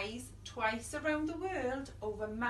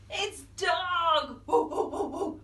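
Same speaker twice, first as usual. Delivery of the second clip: excited reveal; 5 dB louder than usual. Acted.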